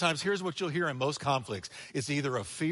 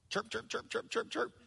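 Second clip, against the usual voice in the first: cricket chirps; 5 semitones higher, 7 dB quieter than usual. Pretend. Preacher imitates cricket.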